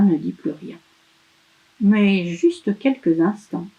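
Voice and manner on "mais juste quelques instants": usual speaking voice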